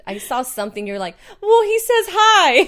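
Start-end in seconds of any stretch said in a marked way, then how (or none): 1.4-2.7 high, loud, drawn-out cheerful imitation; cheery mimicry. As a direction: playfully mimic passing on a greeting. Mimics friend passing on messages.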